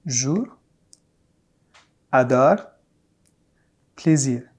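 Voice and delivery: jour, adore, plaisir with a faint French final r; subtle final r. Shown as a demonstration. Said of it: "I repeat".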